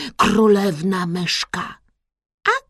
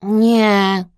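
drawn-out, louder surly no; sullen, grudging reply; grumpy mutter; 6 dB louder than usual. A narrator's reading. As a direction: grunt a refusal. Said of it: "burknął Kresek".

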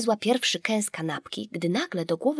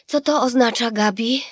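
lightly higher mother's voice; echoing mother; 5 semitones higher, 5 dB louder than usual. A narrator's reading. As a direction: repeat it absently, like an echo. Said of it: "powtórzyła mama jak echo". (mama powtarza jak echo)

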